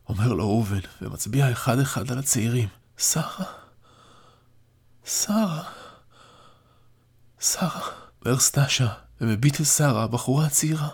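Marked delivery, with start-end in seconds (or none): 3.0-7.8 higher, faint, repeating the name; frail old father. A narrator's reading. Dying grandfather sees his granddaughter, faint and higher. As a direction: breathe her name in wonder.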